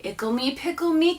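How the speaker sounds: usual speaking voice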